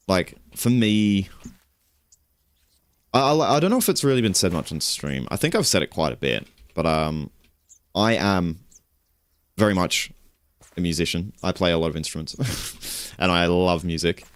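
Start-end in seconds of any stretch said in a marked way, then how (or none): none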